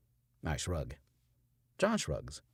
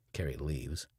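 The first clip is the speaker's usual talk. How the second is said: usual speaking voice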